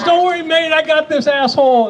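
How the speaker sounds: a hint of a cocky rescuer; Dogfish's voice. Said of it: "And he goes".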